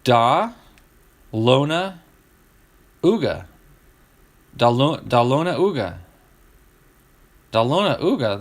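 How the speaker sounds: Da Lona Uga as dah LOH-nuh OO-guh; sounded-out mispronunciation. Shown as a demonstration. Host puzzles out a city's spelling, sounded-out mispronunciation. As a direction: break Da Lona Uga into separate chunks, then join them. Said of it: "That's not how it's spelled".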